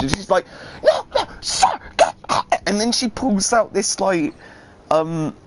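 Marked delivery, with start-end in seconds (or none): 0.8-2.1 much higher, prissy shooing voice; a high, fussy impression of the woman waving him off; high mock refusal. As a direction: shoo him off in a high put-on voice.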